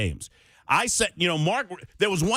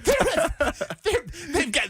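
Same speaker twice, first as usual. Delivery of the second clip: laughing outrage; 13 semitones higher than usual. Real, not acted. Mock outrage while laughing.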